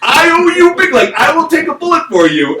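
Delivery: husband gushing thanks at the counselor; gushing gratitude. Retold or imitated. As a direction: pour out the thanks like he saved you.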